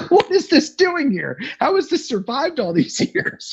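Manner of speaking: high, slow, amazed squeal; incredulous disbelief. Retold or imitated. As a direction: gape at it in wonder, pitching way up. Baffled at the log cabin.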